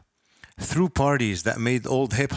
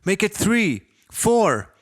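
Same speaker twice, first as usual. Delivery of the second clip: louder and higher with each number, voice climbing; panicked self-scolding; 6 semitones higher, 5 dB louder, slower than usual. Acted. Narrator berating himself in his own head, escalating panicked outburst. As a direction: spiral into panic as the years pile up.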